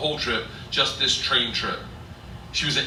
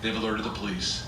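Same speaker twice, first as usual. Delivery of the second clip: smug; 5 semitones lower, 7 dB quieter than usual. Retold or imitated. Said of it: "smiling, and goes".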